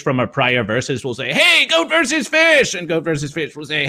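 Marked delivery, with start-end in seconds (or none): 1.3-2.7 high, drawn-out hailing call. Acted. Fish greeting the character at a convention, calling out high. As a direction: hail someone across a crowded hall.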